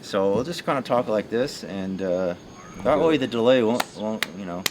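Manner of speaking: usual speaking voice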